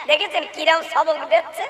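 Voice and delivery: ordinary talk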